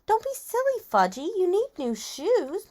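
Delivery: high-pitched, singsong mom voice; coaxing mother. A narrator's reading. Mother telling her son off, high coaxing voice. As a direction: chide a small child in a high voice.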